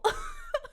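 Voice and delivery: high-pitched real laugh. Real, not acted. Storyteller cracking up at her own punchline, high laugh. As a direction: burst of high laughter after the shout.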